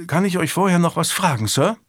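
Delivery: a squire's tentative question to his master; lifted, youthful voice, respectfully asking; young squire. A narrator's reading.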